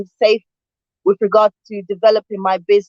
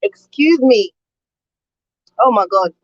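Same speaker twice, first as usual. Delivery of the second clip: flustered after swallowing a fly; recovering from a bug in the throat; just swallowed a bug. Real, not acted. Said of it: "a fly just flew into my mouth".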